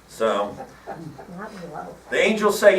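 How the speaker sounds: ordinary talk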